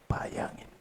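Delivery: hushed whisper of wonder; soft whispered invitation; whispered aside. Real, not acted. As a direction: breathe it to the crowd in a whisper. Awed aside to congregation.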